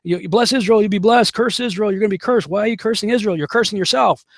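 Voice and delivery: high-pitched mock scolding rant; going off on someone. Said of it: "people start going off on me".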